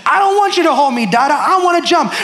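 put-on high-pitched protesting little child; toddler tantrum. Daughter to daddy at the pool, imitated tantrum. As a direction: mimic a small girl throwing a tantrum. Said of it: "she threw a tantrum".

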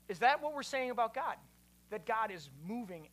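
usual speaking voice

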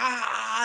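sharp mock cry of pain, pitched high; hammed-up wail of a kid in pain; imitated bawl. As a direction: yelp like the crying kid. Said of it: "bawling tears".

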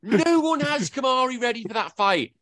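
high, almost squealing protest; emphatic agreement. Real, not acted. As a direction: insist nobody was ready, pitch way up. Exasperated agreement about the boss.

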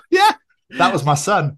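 mum's retort. Retold or imitated. Mum owning up to friend on phone, retorting. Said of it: "And she went".

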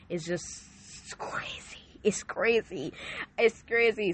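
breathless whisper of disbelief. Real, not acted. Telling the church about her lost voice, whispered disbelief. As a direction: hush it, amazed. Marvelling at voice dropping.

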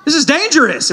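lightly voiced rescuer scolding the lost pair; alarmed snowboarder. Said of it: "And he's like".

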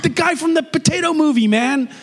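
high, thrilled American fan voice; excitable bank teller. Acted. Teller recognizing the man from the movie, excitable. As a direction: burst out in delighted recognition. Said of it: "there's this excitable, um, teller".

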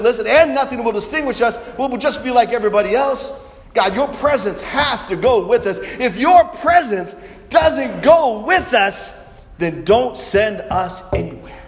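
loud, high, insistent appeal; urgent preacher voicing Moses; impassioned plea. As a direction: plead forcefully, slowing on the condition. Moses insists God go with them.

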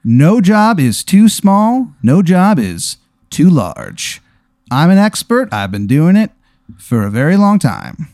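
high, slow infomercial pitchman; over-the-top commercial host, slow and high; cheesy ad guy voice. Acted. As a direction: pitch it like a late-night TV ad. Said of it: "He goes".

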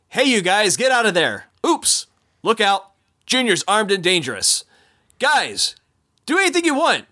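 upbeat tour guide patter; boat skipper spiel. Acted. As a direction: sell the jokes like a jungle skipper. Performs skipper spiel with jokes.

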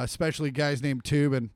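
ordinary delivery

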